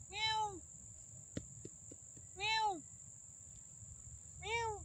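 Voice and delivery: high, mewing cat sounds; playful high kitty meows. Pretend. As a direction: meow like a little cat. Imagining a cat in the casket.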